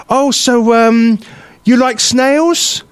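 high, drawn-out patronising questions; imitating a patronising old man.

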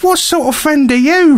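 high, indignant jab; mock outrage. Real, not acted. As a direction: act offended at your friend.

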